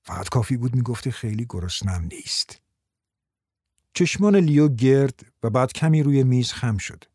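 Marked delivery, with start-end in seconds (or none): 0.0-2.6 low, softened voice; quiet, lowered aside; speaking softly. A narrator's reading. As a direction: lean in and say it softly. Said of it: "آهسته گفت".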